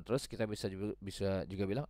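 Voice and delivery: normal talking voice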